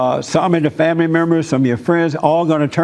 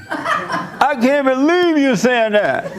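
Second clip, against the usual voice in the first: much higher, very slow, laughing mock outrage; mock outraged cousin; 9 semitones higher, much slower than usual. Mocking the cousin who went off on him, high drawn-out laughing outrage. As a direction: stretch it out, squeaky and appalled, while laughing. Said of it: "And he went off on me".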